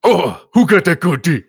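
high, loud, slow comic voice; goofy put-on voice. Riffing with co-hosts, goofy high slow loud voice. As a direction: ham it up like a cartoon.

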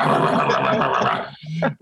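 low rolling dog noise; pit bull imitation. Imitates the band as happy pit bulls.

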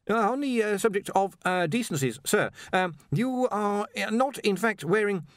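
high, hesitant deferential voice; hesitant underling. A narrator's reading. Ponder tries to point something out to his master, hesitant. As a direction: edge politely toward an awkward point.

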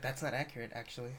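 imitating a muttered correction; hushed, low mock nitpicking; under the breath. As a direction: drop it very soft and low, almost to yourself. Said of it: "under her breath, like, say stuff".

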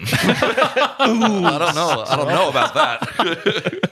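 high, loud laughter breaking up the words; laughing at own cheeky joke. Real, not acted. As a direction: giggle through it, barely getting out each word.

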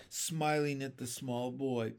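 usual speaking voice

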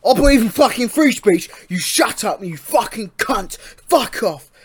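loud mock English bigot rant; hammed-up English accent. Co-host parodying a free-speech ranter, hammed-up English accent. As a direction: bellow a pretend lout's tirade in broad English accent. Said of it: "a fucking proper English accent".

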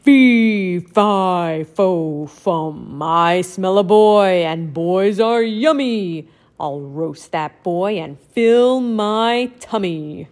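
slow, low hungry giant; dim giant cyclops. Acted. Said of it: "and say".

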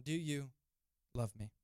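low, halting question; grave question. Acted. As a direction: drop low and pause before the final words. Third asking, grave reading.

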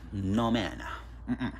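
normal talking voice

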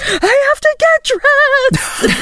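put-on very high, drawn-out little kid wail; worked-up child. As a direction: imitate a child getting all worked up, slowly. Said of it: "He gets, like, really worked up about it".

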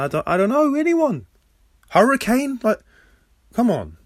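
high, strained pleading for anyone; exasperated suggestion. Real, not acted. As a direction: voice climbing very high. Exasperated search for a better interviewee.

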